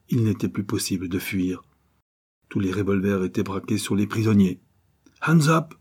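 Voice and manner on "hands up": high, loud sharp order; narrated bandit's shout; shouted command. A narrator's reading. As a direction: call it out as a hold-up order. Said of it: "cria une voix".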